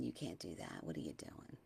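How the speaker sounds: low, hushed, unvoiced whisper of a doubter; critical voice. Mimicking the discouraging voice others use, in a low whisper. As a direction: hiss it low like a nagging inner critic. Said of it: "Instead of saying".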